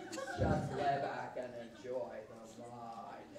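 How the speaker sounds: low, quiet, controlling voice; dominating. Acted. Telling a lover to lie back and enjoy, low and dominating.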